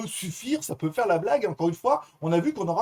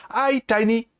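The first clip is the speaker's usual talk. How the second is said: French-accented English greeting; 6 semitones higher, slower than usual. Shown as a demonstration. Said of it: "elle comprend mon anglais de merde".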